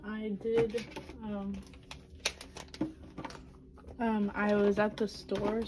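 ordinary talk

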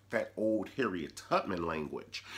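ordinary talk, nothing marked

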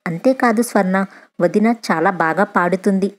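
usual speaking voice

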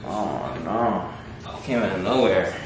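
usual speaking voice

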